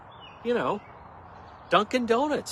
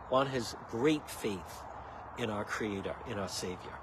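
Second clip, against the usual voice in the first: sincere; 6 semitones lower, 9 dB quieter than usual. Real, not acted. Sincere faith remark.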